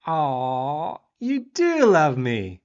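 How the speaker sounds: high, loud, drawn-out swoon; playfully touched, stretched out; mock gushing. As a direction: gush slowly at a loved one. Touched reply to wife.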